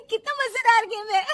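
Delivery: high, loud giggling delight; laughing. Acted. Daughter-in-law laughs watching the game show, giggling. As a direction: laugh through the words.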